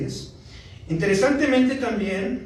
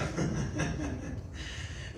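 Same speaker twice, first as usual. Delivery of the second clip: soft laugh; 12 dB quieter than usual. Real, not acted. Laughing after wife joke.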